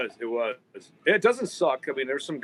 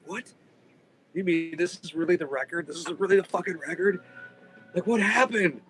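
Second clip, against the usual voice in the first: higher, let-down disbelief; higher voice of stunned disappointment; disbelieving; 6 semitones higher than usual. Retold or imitated. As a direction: ask it as if you can't believe it. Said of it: "It was like".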